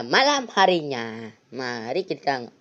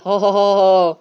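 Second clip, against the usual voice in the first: louder sinister Santa chuckle; evil ho ho laugh; 4 semitones higher, 8 dB louder than usual. Acted. (tawa ho ho yang jahat)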